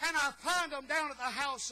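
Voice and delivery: loud, rising revival preacher cadence; preaching at full force. Acted. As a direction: preach it to the rafters with fervour. Preaching worship at the altar.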